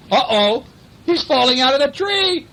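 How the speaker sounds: high, loud cry of alarm. Acted. Reading to a child, crying out as he falls. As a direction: shout it as he tumbles.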